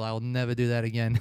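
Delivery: ordinary delivery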